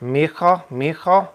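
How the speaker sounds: lightly voiced Mexican uncle calling mijo; a hint of Mexican-Spanish accent; fatherly Spanglish. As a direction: coax him like a kindly tío, in hammy Spanish. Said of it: "I always go".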